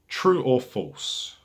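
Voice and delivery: True or false drawn out slowly; a careful, deliberate quiz-host read. Shown as a demonstration. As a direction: lay it out slowly, word by word. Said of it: "I'm gonna style this question carefully".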